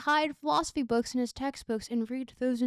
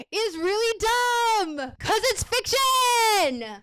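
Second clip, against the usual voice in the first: higher, louder and slow, stretched out; exasperated exclaim; 8 semitones higher, 6 dB louder, much slower than usual. Acted. Narrator mocking the book's premise, exaggerated exasperation. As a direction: play it up as mock outrage. Mock outrage at the book.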